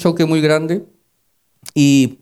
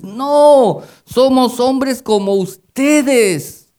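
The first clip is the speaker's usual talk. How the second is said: crying out; 7 semitones higher, much slower than usual. Retold or imitated. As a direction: call out to the crowd, stretched and raised. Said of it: "dice, gritando, dando voces".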